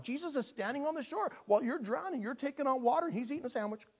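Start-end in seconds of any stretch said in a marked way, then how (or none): none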